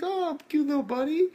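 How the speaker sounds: high, sugary coo; mock sweet villain. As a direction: coo at it like a pet. Villain cooing at frog.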